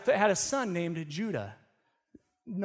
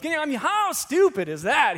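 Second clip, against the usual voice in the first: scornful; 8 semitones higher, 6 dB louder than usual. Retold or imitated. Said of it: "He goes".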